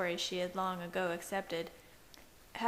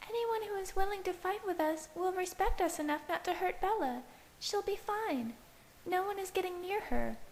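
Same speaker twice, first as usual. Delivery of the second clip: put-on much higher girl's voice, urging; Alice's voice; 11 semitones higher than usual. A narrator's reading. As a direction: press him to stay. Alice pleads with Edward.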